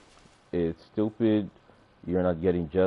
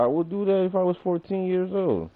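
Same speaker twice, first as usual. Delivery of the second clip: much higher, whiny parody; high mocking voice; 10 semitones higher than usual. Streamer parroting a critic's claim in a high whine. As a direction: parrot it back in a silly high voice.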